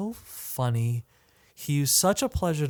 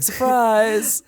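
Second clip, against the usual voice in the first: high, loud cheer; mock surprise-party shout; 7 semitones higher, 6 dB louder than usual. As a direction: call out a party surprise, raised and playful.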